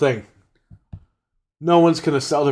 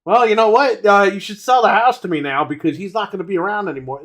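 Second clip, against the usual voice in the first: mock greedy neighbor; 6 semitones higher than usual. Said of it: "and you're like".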